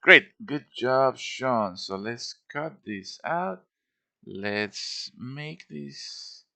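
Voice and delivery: usual speaking voice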